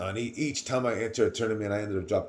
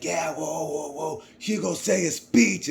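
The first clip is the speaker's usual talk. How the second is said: higher, cheering imitation of the crew; hyped teammates; 6 semitones higher, slower than usual. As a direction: teammates urging Hugo to give a speech, hyped. Teammates hyping him up.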